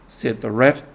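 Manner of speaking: plain reading voice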